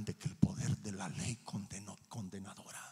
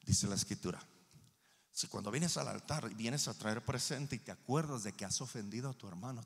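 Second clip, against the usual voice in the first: ordinary delivery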